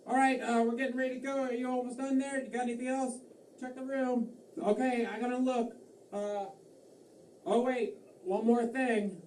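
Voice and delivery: high-pitched call across the room; acted hotel guest. Guest checking the room before leaving, high-pitched and preoccupied. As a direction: call out busily, pitched well above normal.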